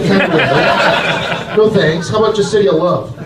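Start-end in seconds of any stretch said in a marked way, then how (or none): 0.0-1.5 stretched out. Acted. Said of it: "drawn-out repeat".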